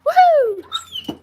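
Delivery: big high shouted whoop; cheering woohoo. Shown as a demonstration. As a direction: belt out a high celebratory whoop. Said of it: "Let's do a woohoo for Hard Life".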